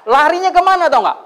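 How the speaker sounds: high, loud eager question; excited. Real, not acted. Preacher teasing the congregation about where they ran, excited. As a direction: build suspense, thrilled.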